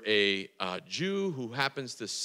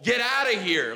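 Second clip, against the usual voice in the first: mock yell; 10 semitones higher, 3 dB louder than usual. Jesus clearing the temple.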